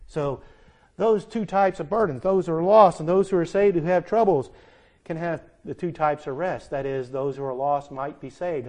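normal talking voice

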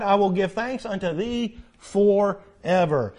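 preacher proclaiming the psalm of praise; solemn preaching. Acted. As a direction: lift each line of the psalm to the rafters. Preaching the scripture aloud.

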